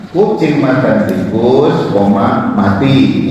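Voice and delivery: slow "kucing makan tikus", spoken "koma", lone "mati"; comma before last word. Shown as a demonstration. Teacher showing students the back comma, pause before "mati". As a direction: run up to a rising "tikus", break, then "mati". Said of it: "Kalau saya taruh di belakang".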